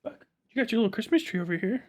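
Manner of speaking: sweet sing-song coax for the pup; high pet talk. Real, not acted. Streamer coos to his dog Buck, high baby talk. Offering the dog a Christmas treat.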